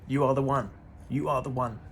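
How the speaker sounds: ordinary talk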